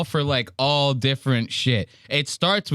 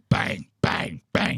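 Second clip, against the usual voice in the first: 1 Joey Diaz imitation; 7 semitones lower, slower than usual. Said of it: "He sounded like Joey Diaz".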